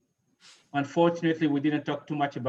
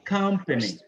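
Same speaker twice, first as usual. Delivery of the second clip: "Company" with COM standing out; weight the opening syllable of "Company"; stress on first syllable; 3 semitones higher than usual. Shown as a demonstration. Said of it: "the first one is stressed".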